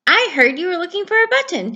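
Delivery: busy little raccoon; quick raccoon. Acted. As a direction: rattle it off eagerly.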